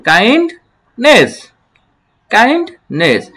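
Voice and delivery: "Kindness" slowly, rising on "kind", falling on "নেস"; "kindness" in two separate pieces; broken into syllables. Shown as a demonstration. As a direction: lift "kind", pause, drop "নেস". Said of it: "তাহলে কি দাঁড়াচ্ছে?".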